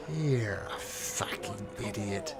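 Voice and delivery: slow, soft voice from the shadows; dark whisper behind you. Acted. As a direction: taunt from the darkness, slow and quiet. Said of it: "voice from the shadows".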